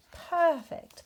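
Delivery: high, loud and pleased; delighted. A narrator's reading. Rabbit and Bear admire their snowman, delighted. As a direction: beam it out together.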